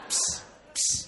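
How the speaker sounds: voiceless "Ps, ps" puffed through the lips; practising ps. Shown as a demonstration. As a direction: practise the "Ps" sound on request. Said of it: "A ver, haga ps".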